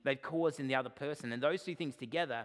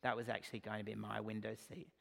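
passive-aggressive forgiveness; 4 semitones lower, 8 dB quieter than usual. Retold or imitated. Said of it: "passive-aggressively going to somebody and saying".

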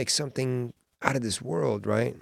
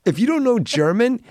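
loud, high-pitched protest; comic exasperation; 8 semitones higher, 6 dB louder than usual. Real, not acted. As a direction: rant in baffled frustration. Exasperated at unspellable German.

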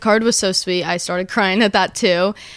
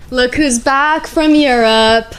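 playful welcome; 2 semitones higher, 5 dB louder, slower than usual. Real, not acted. Presenting friend back from Europe.

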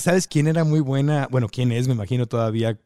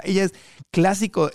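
normal talking voice

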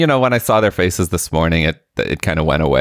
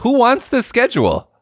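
mock outrage. Mocking overloaded kids' schedules.

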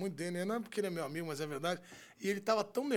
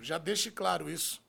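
usual speaking voice

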